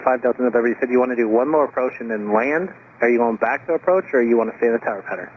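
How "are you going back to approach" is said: ordinary talk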